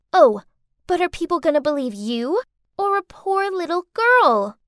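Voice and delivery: put-on sly taunting girl.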